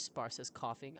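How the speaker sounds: ordinary talk, nothing marked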